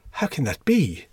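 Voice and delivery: high, astonished question; scandalised, rising voice; amazed cry. A narrator's reading. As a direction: cry out in disbelief. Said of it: "he cried".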